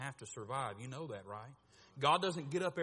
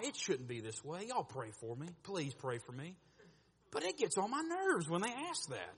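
ordinary talk

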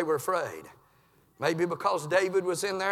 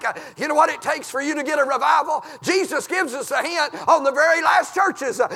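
loud, high preaching holler; full-force revival preaching; 7 semitones higher, 6 dB louder than usual. Acted. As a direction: hammer it home from the pulpit.

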